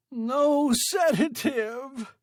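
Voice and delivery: very high, slow, loud scream. A narrator's reading. Stranded man refusing sedative, drawn-out scream. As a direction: drag the scream out in panic. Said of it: "screamed Sale".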